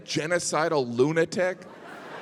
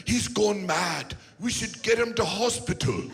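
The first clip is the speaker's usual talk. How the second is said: bad British accent; 4 semitones higher than usual. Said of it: "your British accent is getting worse".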